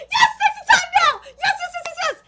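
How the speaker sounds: high, loud, rapid-fire yeses; excited cheering. Real, not acted. Reader cheering the characters' embrace, shouting with excitement. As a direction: squeal it, urging them on.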